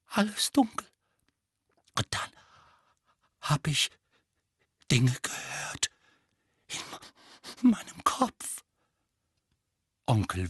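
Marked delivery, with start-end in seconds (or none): 0.1-8.6 frightened whisper. A narrator's reading. Dudley terrified, whispering.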